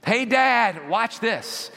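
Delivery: put-on high, loud, excited child's call; kid calling out to Dad. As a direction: call to your dad across the waves. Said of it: "you will hear the kid say".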